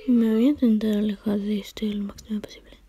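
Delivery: hushed whisper. Real, not acted. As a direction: whisper it while sneaking away. Sneaking away from character.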